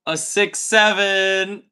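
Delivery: slow, loud drawn-out punchline. Acted. Streamer delivering the punchline to a viewer. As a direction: drag out the joke answer. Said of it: "What do you call an ill seven?".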